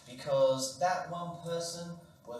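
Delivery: usual speaking voice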